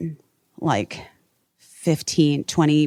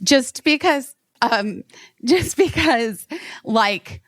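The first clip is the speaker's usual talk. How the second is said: halting, higher, fighting back tears; choked up; 7 semitones higher than usual. Real, not acted. Recalling the screaming crowd, choked up. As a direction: keep breaking off as tears come. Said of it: "I'm gonna cry again".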